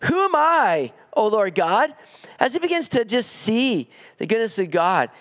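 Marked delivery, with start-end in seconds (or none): 0.0-1.9 awed outcry. Acted. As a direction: cry out as if overwhelmed by God's goodness. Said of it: "He says".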